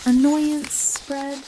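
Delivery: usual speaking voice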